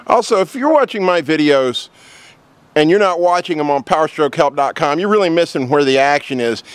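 ad read. Acted. Sponsor plug read to camera.

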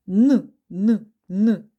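Н said three times as a loud, humming n; a clear n sound, repeated. Shown as a demonstration. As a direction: hum out the Н for the class. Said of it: "Приголосний".

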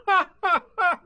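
high, loud put-on "ha, ha, ha"; careless laugh. A narrator's reading. Rabbit pretends he isn't lost, careless laugh. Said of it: "he gave the careless laugh".

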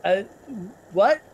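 startled what. Real, not acted. Startled by stranger.

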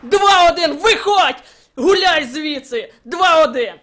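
loud gleeful yells with laughter; triumphant shouting. Real, not acted. Gamer shouting at the beaten opponent. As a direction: crow over the opponent. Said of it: "що я кричу як псих".

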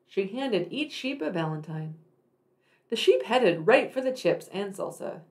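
usual speaking voice

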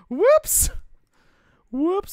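very high, loud playful whoops; jokey, sing-song whoopsie at his own misplay; mock whoops. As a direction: squeal it like a cartoon oops. Mock apology after misplay.

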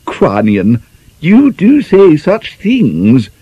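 high, slow, pained ladylike protest; a prim young woman scandalised, drawn out; lamenting prim lady. Acted. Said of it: "lamented Miss Rattery".

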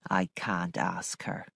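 low, soft, sorrowful housekeeper; sad. A narrator's reading. Missus Grose reporting on sick Flora, sadly. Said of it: "Missus Grose said sadly".

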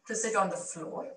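ordinary delivery

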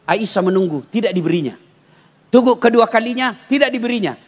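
usual speaking voice

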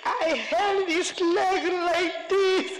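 ordinary talk

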